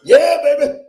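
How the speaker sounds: put-on loud, high, laughing yeah baby; hammed-up catchphrase. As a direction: belt it out like a cheesy showman. Comedian's catchphrase opener.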